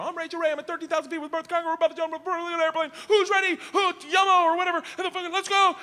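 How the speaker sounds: fast, high perky camera read; hyped TV host to camera. Retold or imitated. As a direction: rattle off a one-take host intro.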